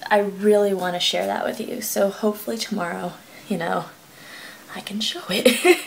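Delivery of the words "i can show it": whisper. Real, not acted. Late night hush.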